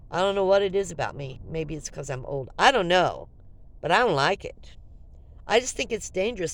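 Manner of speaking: ordinary talk, nothing marked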